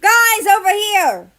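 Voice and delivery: high, loud, slow call from afar. Acted. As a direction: shout to the group down the alley. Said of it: "Australia called from the alley".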